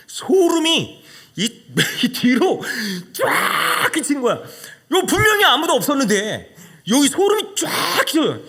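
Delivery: high, loud, shuddering with fear and laughter; terrified. Retold or imitated. Preacher tells the congregation how chills struck, terrified. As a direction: relive the chill running up your spine.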